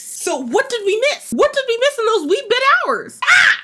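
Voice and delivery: high teasing, ends in a loud squeal. Real, not acted. Vlogger teasing about the missing hours, high squeal. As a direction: tease it up into a shriek.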